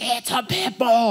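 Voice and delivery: high, slow imitated mom voice; mom's reaction. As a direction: play the shocked mom, drawn out and high. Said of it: "she's like".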